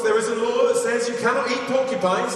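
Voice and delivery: high-pitched storyteller's reproach; mock admonishing. Villager warning a porcupine hunter, mock admonishing. Repeated warning acted in fable.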